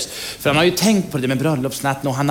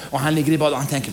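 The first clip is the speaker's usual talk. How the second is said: ordinary delivery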